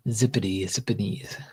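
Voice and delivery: whispered nonsense words to yourself; playful whisper. Real, not acted. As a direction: breathe the silly words out, no voice. Playfully murmuring a made-up word.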